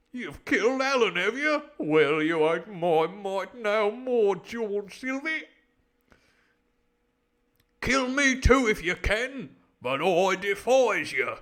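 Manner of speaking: loud, high, slow declaiming; booming, drawn-out challenge to a killer; defiant sailor. Acted. Sailor defying Long John, loud and outraged.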